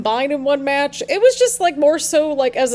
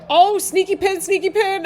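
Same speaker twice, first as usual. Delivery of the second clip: excited call; 8 semitones higher than usual. Real, not acted. Calling a near pinfall.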